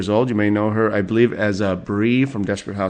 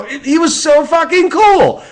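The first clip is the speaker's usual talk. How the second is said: much higher and louder, bursting with admiration; gushing; 7 dB louder than usual. Real, not acted. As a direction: blurt it out starstruck. Gushing about meeting Heston.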